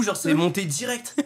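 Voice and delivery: usual speaking voice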